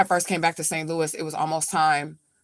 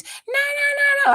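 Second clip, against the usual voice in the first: high shrill shout in the background; screaming; 3 dB louder than usual. Retold or imitated. Grandma screaming in the background at the daughter. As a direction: scream no over and over. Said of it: "she just yelling, she's screaming".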